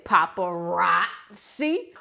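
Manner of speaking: "paparazzi" stretched slowly with a lift at the end; "paparazzi" drawn out with emphasis; oomph on paparazzi. Shown as a demonstration. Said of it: "I put some oomph behind it".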